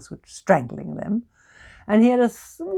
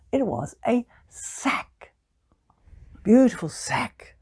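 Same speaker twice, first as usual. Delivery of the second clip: hushed reveal; 4 semitones higher, slower than usual. A narrator's reading. Storyteller hushes, slows.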